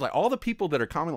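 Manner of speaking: ordinary talk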